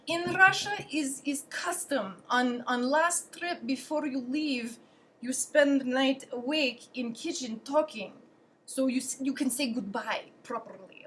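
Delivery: put-on slow, deliberate Russian-accented English; ponderous mock Russian English. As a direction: imitate a Russian host explaining custom. Acted quote of Russian host Sergey.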